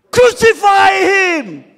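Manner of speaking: loud, drawn-out mob yell; angry crowd shout. Pretend. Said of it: "They all answered him".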